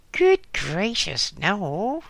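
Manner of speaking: exclaiming. Acted. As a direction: burst out in amused disbelief. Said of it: "she exclaimed".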